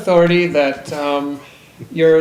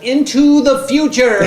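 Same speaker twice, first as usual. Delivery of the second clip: dramatic announcement; 11 semitones higher, 9 dB louder, slower than usual. Pretend. Dramatic time-travel joke.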